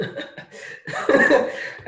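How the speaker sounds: short breathy giggle at a glitch; breathy laugh. Real, not acted. Laughs at annotation mishap.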